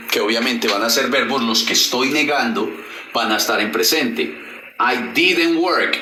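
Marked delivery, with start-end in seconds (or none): 4.8-6.0 "I didn't work" said very slowly, each word apart; slow model sentence. Shown as a demonstration. Teacher models "I didn't work" slowly for students. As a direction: spell it out slowly for the class. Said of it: "work va a ir en presente".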